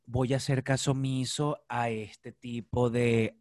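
slow, low, measured restraint; acted icy composure in a quarrel; composed Vanessa Senior. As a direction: deliver a drawn-out formal warning, keeping calm. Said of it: "Vanessa Senior es muy arrecha".

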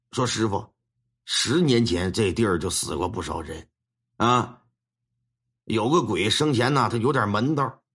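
put-on low, grave voice of an old master lamenting. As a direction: weigh each word like a worried old Taoist master. Master reports to his own master. (低沉凝重的老道长口吻)

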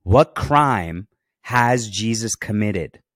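loud, slow, emphatic demand. A narrator's reading. Pilate questioning the crowd about the crime, demanding. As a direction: press each word hard and slowly. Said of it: "Pilate demanded".

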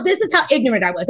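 usual speaking voice

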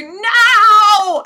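high, loud shout of no. Pretend. Player turning the yelp into a cry, loud no. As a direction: yell it in alarm. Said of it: "turn it into".

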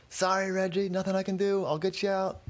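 high-pitched shout, held back in volume; mock yell. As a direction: call out high as if yelling from a distance. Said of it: "Donnie yelling".

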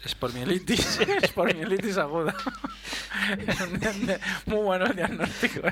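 laughing high through the words; can't stop giggling. Real, not acted. Laughing at the joke diagnosis.

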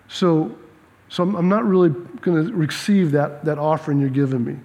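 low, soft, patient God voice; calm voice of God. Retold or imitated. God gently refusing Cain's offering, low and soft. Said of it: "God's like".

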